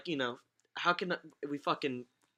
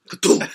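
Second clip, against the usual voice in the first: sudden loud vocal thunk; loud thud imitation; 11 dB louder than usual. Telling how his brother dropped to the floor, loud thud noise. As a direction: punch out a cartoonish impact noise.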